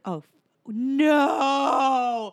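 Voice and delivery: slow drawn-out horrified gasp; stretched out in disbelief; dismayed shock. Retold or imitated. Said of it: "And you're like".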